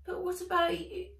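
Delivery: put-on a plucky girl saying no; girl refusing. Nat worried for Fizz.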